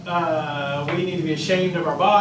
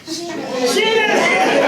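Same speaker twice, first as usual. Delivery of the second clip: laughing accusation; 10 semitones higher, 4 dB louder, much slower than usual. Retold or imitated. Adam blaming the woman.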